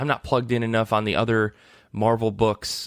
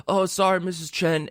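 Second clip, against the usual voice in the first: bad Brooklyn accent, pitched up; Tom Hardy's bad Brooklyn accent; 6 semitones higher than usual. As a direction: put on a clumsy Brooklyn accent. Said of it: "my best Tom Hardy doing a bad Brooklyn accent".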